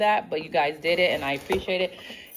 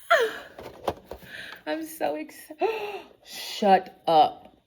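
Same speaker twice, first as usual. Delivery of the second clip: much higher squeal and gasp; excited squeal; 11 semitones higher, slower than usual. Real, not acted. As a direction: squeal in disbelief. Squealing at gift.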